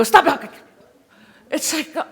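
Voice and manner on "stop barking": loud sharp command; yelling at dog. Retold or imitated. Owner yelling at a barking dog, loud sharp command. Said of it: "you go".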